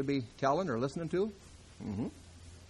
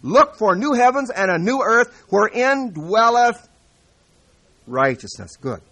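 loud, high, slow preacher reading scripture; fiery pulpit proclamation, louder and higher; full-force preaching; 7 semitones higher, 12 dB louder than usual. Acted. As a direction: thunder out the verses to the congregation.

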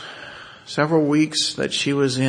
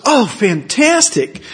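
higher, louder and slow thrilled exclamation; delighted; 11 semitones higher, 10 dB louder, slower than usual. Retold or imitated. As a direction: savor the good news. Doctor thrilled patient is bilingual.

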